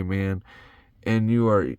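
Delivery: ordinary talk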